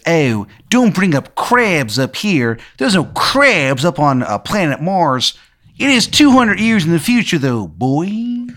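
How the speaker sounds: hammed-up Maryland local setting someone straight; terrible Maryland accent. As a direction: lean hard on the stretched Maryland vowels. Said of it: "he's got, like, a terrible Maryland accent".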